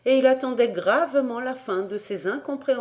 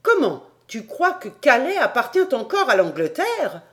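high, raised, incredulous questioning; astonished outcry; 5 semitones higher, 6 dB louder than usual. A narrator's reading. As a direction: demand it in sheer disbelief. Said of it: "s'écria Paganel". (question stupéfaite, incrédule)

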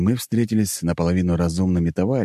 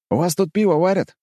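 put-on young guy voice; 12 semitones higher, 3 dB louder than usual. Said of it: "спросил молодой человек".

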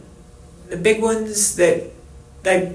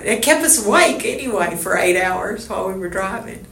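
normal talking voice

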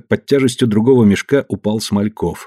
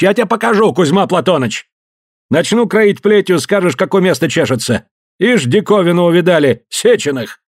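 threatening shout; 9 semitones higher than usual. Acted. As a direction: threaten them with the whip. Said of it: "Он шумел, грозился".

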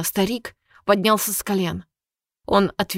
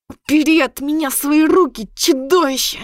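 higher, louder furious growl; growled through teeth; 7 semitones higher, 4 dB louder than usual. Acted. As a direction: snarl it through gritted teeth. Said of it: "Сквозь зубы прорычала она".

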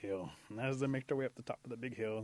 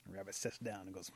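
usual speaking voice

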